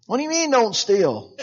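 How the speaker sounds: high, laughing "What do you mean, Don't steal?"; mock incredulous. A listener questioning the preacher's advice, laughing and incredulous. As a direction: play a congregant baffled by "Don't steal".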